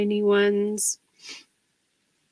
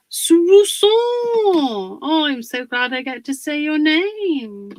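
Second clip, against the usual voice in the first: much higher and louder, thrilled to see them; light up at the name; delighted greeting; 6 semitones higher, 6 dB louder than usual. Real, not acted. Excited greeting of a viewer.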